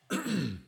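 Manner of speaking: sharp throat-clearing cough; coughing fit. Real, not acted. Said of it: "I apologize for coughing".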